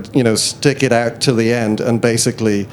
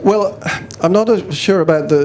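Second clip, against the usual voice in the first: ordinary delivery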